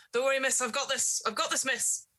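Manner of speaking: put-on high, urgent old gardener reassuring; gruff gardener. Gardener wrestling the panicked woman off the player, urgent. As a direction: wrestle her back while calming the miss. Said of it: "And he's like".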